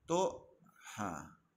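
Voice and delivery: ordinary delivery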